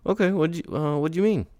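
high, tight annoyed okay; through your teeth. Acted. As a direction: talk through gritted teeth. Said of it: "talking through your teeth".

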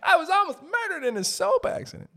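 very high, laughing mock-dramatic squeal; shrieking comic overstatement. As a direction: squeal it through laughter like a melodrama. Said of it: "laughs before mock dramatic line".

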